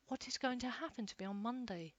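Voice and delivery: ordinary delivery, nothing marked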